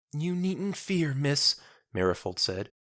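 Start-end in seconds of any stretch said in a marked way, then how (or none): 0.1-1.6 put-on a kindly young church sister soothing a stranger; gentle churchwoman. Marifold consoling Jenna in a high gentle voice.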